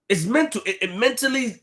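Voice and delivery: ordinary delivery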